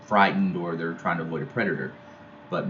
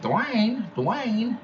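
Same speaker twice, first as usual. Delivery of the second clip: squeaky, much higher parrot voice; parrot imitating. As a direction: mimic the parrot copying mom. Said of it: "the bird would go".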